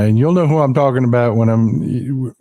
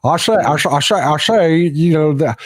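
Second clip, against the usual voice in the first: Foghorn Leghorn impression; 7 semitones higher than usual. Mocking a senator who plays up his Southern act on camera. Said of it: "he turns into Foghorn Leghorn".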